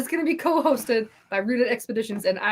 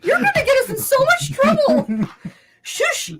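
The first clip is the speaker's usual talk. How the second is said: squealing through laughter, much higher and louder; laughing protest; 6 dB louder than usual. Real, not acted. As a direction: beg them to stop while cracking up.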